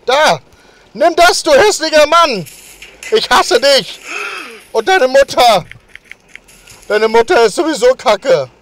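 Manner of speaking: loud, high furious yelling; angry shouting. Acted. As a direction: scream insults at the neighbor. Yelling insults at the neighbor.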